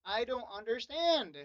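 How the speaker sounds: ordinary talk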